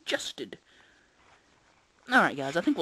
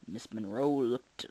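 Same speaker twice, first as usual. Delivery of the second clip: ordinary delivery, nothing marked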